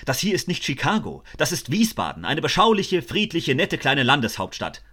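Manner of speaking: high, loud, ranting police inspector; furious shouting. A narrator's reading. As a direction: rant at full volume, losing control. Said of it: "brüllte er".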